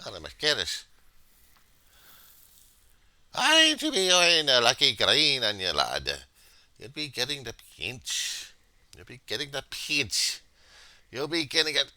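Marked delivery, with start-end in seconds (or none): 3.3-11.9 high, loud mock Irish lilt; Irish accent. Vlogger teasing viewers without green, in a mock Irish accent. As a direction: warn them of the pinch in stage Irish. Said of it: "I don't know how to do this accent".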